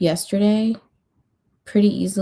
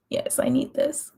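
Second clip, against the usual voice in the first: whispered; 5 semitones higher, 6 dB quieter than usual. Retold or imitated. Happy quote said under breath.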